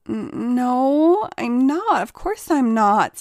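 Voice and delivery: breathy stammer. A narrator's reading. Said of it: "stammered Ray in a breathy feminine voice".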